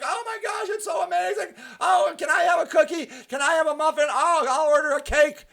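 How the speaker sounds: high, excited gushing customer; gushing friends. Retold or imitated. Friends at the bakery opening gushing, high and excited. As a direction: squeal like a thrilled friend at a grand opening. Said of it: "they're like".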